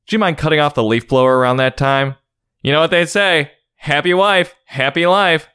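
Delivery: high, prying busybody neighbor; nosy neighbor voice. Pretend. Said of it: "This narrator sounds like the nosy neighbor".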